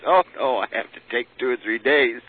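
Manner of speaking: a hint of the medium brushing it off; lightly voiced medium refusing to hurry.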